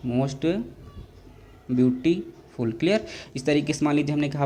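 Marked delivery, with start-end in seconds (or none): 0.1-2.8 slow. Shown as a demonstration.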